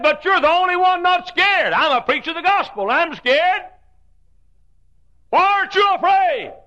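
loud, high, desperate pleading; a scared grown man begging for an answer; frightened preacher. Retold or imitated. Frightened preacher voiced in story.

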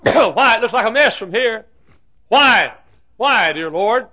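high, loud pleading cry; anguished protest. Acted. Preacher questioning the Lord about life's mess, high anguished cry. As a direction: demand an answer from heaven.

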